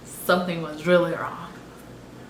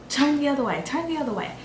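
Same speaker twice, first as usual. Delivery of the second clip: lightly voiced nurse, higher and repeated; urgent nurse; 7 semitones higher, much faster than usual. Nurse to patient as the heartbeat is lost, mildly urgent. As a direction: imitate a nurse's urgent instruction.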